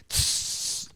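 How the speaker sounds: hissed mace spray imitation; sharp unvoiced "tss" of a spray can; spray noise. Showing how he maced all of them, hissed spray noise.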